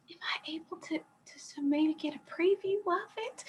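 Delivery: high, breathless near-silent whisper of a shy student; meek shy whisper. Shown as a demonstration. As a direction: whisper it timidly, like a scared old lady. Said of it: "that person just got eighty-seven years old and near silent".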